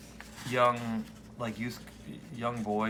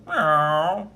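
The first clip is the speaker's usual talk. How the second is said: kitten meow; 3 semitones higher, 8 dB louder than usual. Acted. Kitten voiced.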